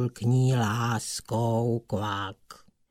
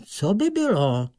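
plain reading voice

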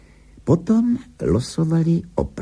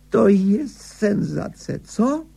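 high-pitched, drawn-out delight; excited exclamation; 12 semitones higher, slower than usual. A narrator's reading. Angler marvelling at his first catch, excited. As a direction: exclaim slowly and high, thrilled. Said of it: "zvolal nadšeně Higgins".

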